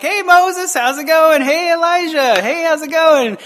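imagine Jesus casually waving at Moses and Elijah; cheery casual greeting. Pretend.

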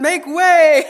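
loud, high proclaiming cry; John the Baptist. Pretend. Father imitating a prophet to the crowd, loud proclamation. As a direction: call out like a prophet clearing a path. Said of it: "Like John the Baptist".

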